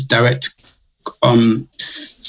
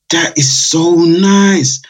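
slow, raised-pitch imitation of delight; delighted customer; 4 semitones higher, much slower than usual. Customers tasting the drink, mildly hammed-up delight. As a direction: draw it out like an impressed taster.

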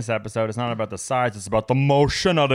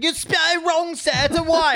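put-on high, louder whiny kid voice; mock kids; 4 dB louder than usual. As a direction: mimic snotty pupils correcting him. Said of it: "everyone was like".

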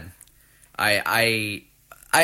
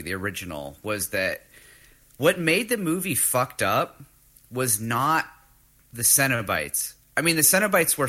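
ordinary delivery

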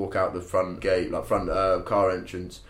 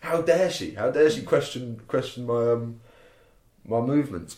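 higher, hammed-up indignation; mock-offended about the old lady; mock outrage; 3 semitones higher than usual. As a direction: play the offended drunk. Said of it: "obviously just like".

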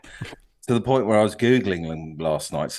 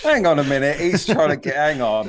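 higher and louder protest; 6 semitones higher, 4 dB louder than usual. Real, not acted. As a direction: hang on, rising indignation. Protesting against being blamed.

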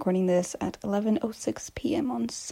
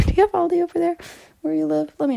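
laughing; 7 semitones higher, 6 dB louder, much faster than usual. Real, not acted. Laughing question to listeners.

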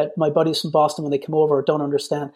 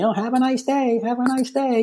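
high, sing-song mock pleasantry; 8 semitones higher than usual. Friends slagging someone for American manners, mocking. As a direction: mimic them slagging you, pitched well up. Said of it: "they used to slag me".

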